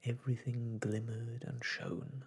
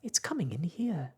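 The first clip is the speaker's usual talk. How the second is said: urgent shout; 7 semitones higher, 5 dB louder than usual. Acted. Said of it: "shouted Jane suddenly".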